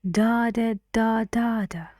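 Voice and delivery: strong high first "da", then stepping down; rhythm demo. Shown as a demonstration. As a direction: tap out a sentence's stresses on "da". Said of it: "sentence stress and".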